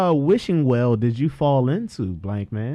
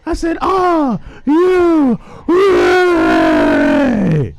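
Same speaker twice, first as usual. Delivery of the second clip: shouting; 7 dB louder, much slower than usual. Acted. Wrestling hype call, shouted.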